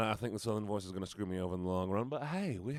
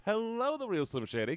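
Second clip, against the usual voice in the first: excited greeting; 13 semitones higher than usual. Real, not acted.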